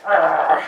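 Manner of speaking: growled arrgh of exasperation; frustrated groan. Acted. Frustrated outburst after plea.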